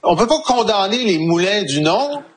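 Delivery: ordinary talk, nothing marked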